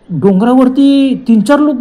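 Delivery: usual speaking voice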